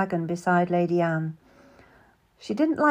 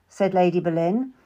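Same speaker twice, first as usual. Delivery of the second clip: plain reading voice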